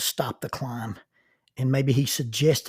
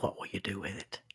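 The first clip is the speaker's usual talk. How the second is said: hushed, much softer whisper; whispered question; 4 semitones lower, 10 dB quieter than usual. Real, not acted. Host challenging listeners at the end, whispered. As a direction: ask it close and intimate. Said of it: "closing question whispered".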